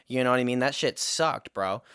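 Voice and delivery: ordinary talk, nothing marked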